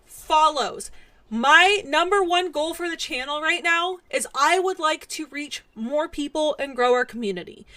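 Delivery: usual speaking voice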